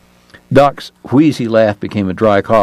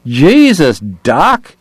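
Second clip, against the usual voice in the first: Eddie's cry; 4 semitones higher, much slower than usual. Acted. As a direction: cry out, queasy at the gory details. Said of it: "Eddie cried".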